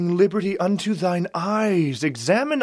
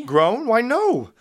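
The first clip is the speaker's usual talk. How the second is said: surprised; 7 semitones higher, 7 dB louder, slower than usual. Acted. Benvolio reacts to "shall I groan.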